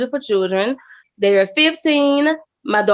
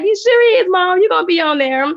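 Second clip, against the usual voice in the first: imitating excited kids; 8 semitones higher than usual. Her kids cheering her on.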